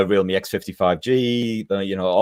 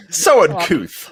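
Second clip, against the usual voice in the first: slow, higher, hammed-up gentlemanly disapproval; mock disdain; 5 semitones higher, 4 dB louder, much slower than usual. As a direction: draw it out like an appalled gentleman. Playing offended proper gentleman.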